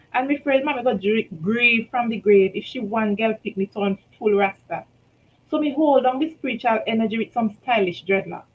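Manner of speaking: usual speaking voice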